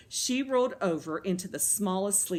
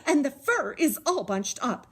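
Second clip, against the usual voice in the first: higher, louder Mama Bear complaint; huffy; 6 semitones higher, 6 dB louder than usual. A narrator's reading. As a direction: huff it out, put out. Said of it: "she huffed".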